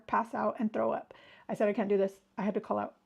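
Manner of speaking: ordinary delivery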